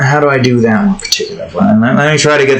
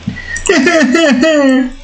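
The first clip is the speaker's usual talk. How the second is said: high, put-on character cackle; Oscar laugh; 11 semitones higher than usual. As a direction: chuckle in a very high cartoon voice. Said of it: "it's supposed to sound like Oscar".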